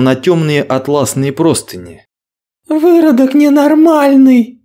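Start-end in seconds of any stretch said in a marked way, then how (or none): 2.7-4.6 very high, loud slow scream; screaming in rage. Acted. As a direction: scream the insult in disgust. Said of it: "заорала она".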